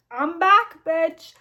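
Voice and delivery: loud, high, cocky announcement; hammed-up gloating entrance; triumphant return. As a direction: announce a villain's comeback with swagger.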